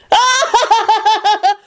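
booming laugh. A narrator's reading. Magnified Kenny laughing.

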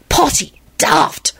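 put-on high, slow boy's hiss; drawn-out, knowing stage hiss. A narrator's reading. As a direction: hiss it back knowingly, word by word. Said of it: "Tim hissed back knowingly".

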